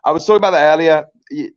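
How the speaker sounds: ordinary talk, nothing marked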